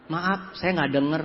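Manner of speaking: low, slow, softened apology; hard-of-hearing prospect. Retold or imitated. Deaf prospect answering the speaker at closing, slow and subdued. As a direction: mimic a slow, unhearing listener. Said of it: "Pas closing gua tanya".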